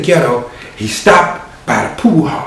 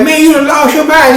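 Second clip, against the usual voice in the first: much higher, loud disbelief; cousin's high scold; 13 semitones higher, 4 dB louder than usual. Acted. Cousin telling Marquette he lost his mind, high loud scold.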